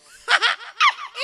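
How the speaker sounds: put-on high, loud kid's giggle; childish giggle. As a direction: laugh like a little kid. Child voiced laughing. (બાળક જેવું ઊંચું, મોટું હાસ્ય)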